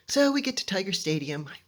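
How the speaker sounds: usual speaking voice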